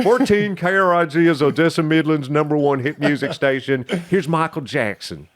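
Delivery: mock Texas DJ, Kermit-like; thick Texas accent. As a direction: read the station ID in a heavy Texas accent. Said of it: "a cross between Forrest Gump and Kermit the Frog".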